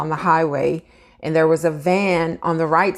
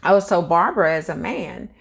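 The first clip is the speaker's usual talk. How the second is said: ordinary talk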